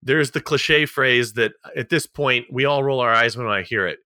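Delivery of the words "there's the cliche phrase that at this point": ordinary talk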